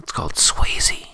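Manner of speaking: hushed, slow whisper. Real, not acted. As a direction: breathe it secretly. Whispered joke brand name.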